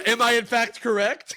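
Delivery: high, loud question through laughter; mock debater, laughing. Podcaster joining in mocking the commenter, laughing. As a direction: pose it as a pompous debater while cracking up.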